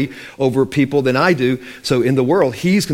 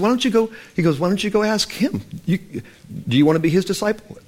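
cheeky retort; 6 semitones higher than usual. Acted. Healed man taunts Pharisees.

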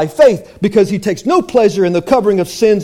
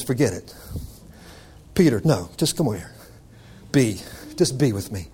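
much softer, low and gentle; soft intimate Jesus; 9 semitones lower, 7 dB quieter than usual. Acted. Jesus calling his disciples close, soft and gentle. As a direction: hush it like a tender call.

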